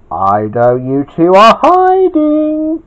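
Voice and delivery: slow, rising singsong call; calling out to hiders. A narrator's reading. Gawain calls to hidden pair.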